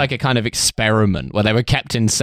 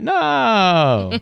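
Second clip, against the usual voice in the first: chorus no; 9 semitones higher than usual. Retold or imitated. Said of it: "they're all like".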